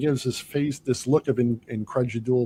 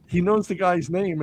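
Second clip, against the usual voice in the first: ordinary talk, nothing marked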